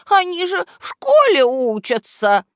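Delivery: very high, loud henchman squeak; daring objection. A narrator's reading. Henchman defending the schoolkids to his boss, high squeaky protest. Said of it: "осмелился возразить ему вреднюга".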